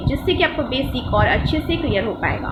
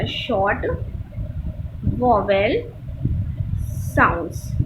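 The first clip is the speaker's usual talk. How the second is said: Short, vowel, sounds each said alone with long pauses; slow, word by word; 4 semitones lower, slower than usual. Shown as a demonstration. Teacher slowly reads out the title to students. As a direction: lay each word down separately. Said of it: "second आपका था short vowel sounds वाला".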